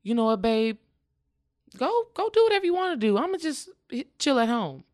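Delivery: replaying herself cheerfully sending her husband off; upbeat and breezy. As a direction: sell it cheerfully so he feels free to go. Acting out telling husband go.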